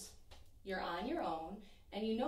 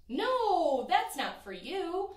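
higher and louder, firmly refusing; emphatic loud no; 8 semitones higher, 8 dB louder, slower than usual. A narrator's reading. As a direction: shut the idea down with a ringing no. Narrator rejects the waiting place.

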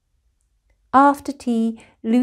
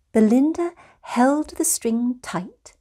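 ordinary delivery, nothing marked

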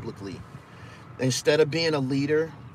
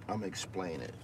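much softer, lower, almost to yourself; softer repeat; 6 semitones lower, 12 dB quieter than usual. Real, not acted. Repeating to the live-stream chat, much softer. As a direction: drop it under your breath.

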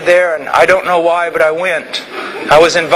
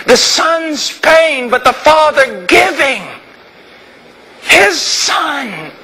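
slow, hushed and higher, overwhelmed; slow awe; 6 semitones higher, slower than usual. Real, not acted. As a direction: let the wonder of it slow you right down. Awed realization of the father's sacrifice.

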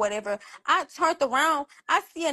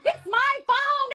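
much higher, slow, emphatic yell; real shrieking rant; 13 semitones higher, slower than usual. Real, not acted. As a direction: hammer each word in outrage. Real shouting, phone bill.

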